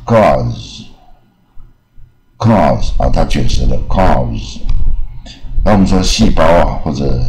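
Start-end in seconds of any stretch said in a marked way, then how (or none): 0.0-4.6 "cause" with long open vowel, silent e; drawn-out "cause" ending in "z"; curled-tongue model. Shown as a demonstration.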